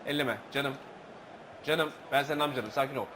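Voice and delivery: low, soft calming words; quiet reassuring uncle; soothing. Real, not acted. Soothing someone off-mic.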